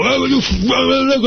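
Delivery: high-pitched mock sputtering curse; mimicking the agitated man. Retelling the homeless man cursing him out, high mock sputter. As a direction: imitate a ranting stranger, voice jumping high. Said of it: "and it's cursing me out".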